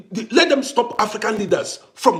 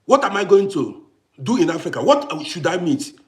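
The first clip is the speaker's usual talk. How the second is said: usual speaking voice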